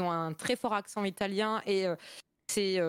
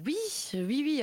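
Lorrain accent; 7 semitones higher than usual. Real, not acted. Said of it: "c'est mon accent lorrain".

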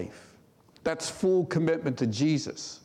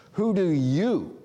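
slow, louder "Who do you", weight on "you"; emphasis on you; 4 semitones higher, 4 dB louder, slower than usual. Shown as a demonstration. Preacher drilling the Greek emphasis on "you", slow and pressed. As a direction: drag out "Who do you" and land hard on "you". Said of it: "So that's emphasis".

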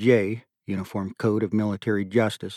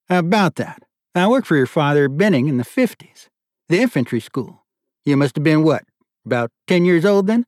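raised, louder, pleased colonel; delighted colonel; 6 semitones higher, 6 dB louder than usual. A narrator's reading. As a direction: warm up as he recognises the name. Delighted colonel recalls the father.

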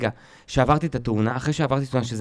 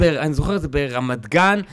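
ordinary talk, nothing marked

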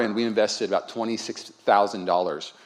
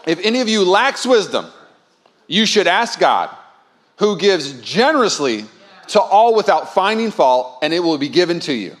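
slow, louder reading of the verse; solemn scripture reading; 6 semitones higher, 6 dB louder than usual. Acted. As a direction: read it out to the congregation, weighty and slow. Reads the scripture verse aloud.

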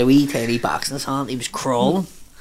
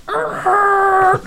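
long high screeching brake noise; imitated car screech; 6 dB louder than usual. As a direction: squeal like tyres stopping hard. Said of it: "it was just like".

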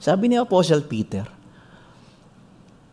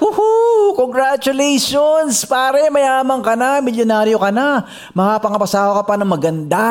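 higher, louder mock cheering; crowd cheering; 9 semitones higher, 3 dB louder than usual. Guests cheer the winning swimmer, loud and excited. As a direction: celebrate like a rowdy crowd.

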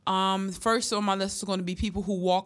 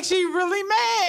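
mock shocked cry; 9 semitones higher, 4 dB louder, slower than usual. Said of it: "'Cause I'm like".

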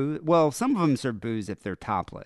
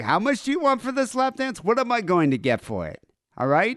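mock haggling customer; 12 semitones higher than usual. Stingy strip club customer haggling over a lap dance, high mock voice. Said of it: "it's like".

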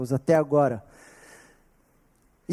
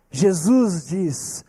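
ordinary talk, nothing marked

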